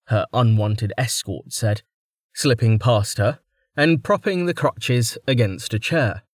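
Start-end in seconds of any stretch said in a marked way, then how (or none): none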